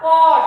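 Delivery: पॉट said loudly with a clear open O; loud teacher model of पॉट; model word. Shown as a demonstration. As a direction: call out पॉट crisply for the class. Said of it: "पहले किसकी sound आ रही है".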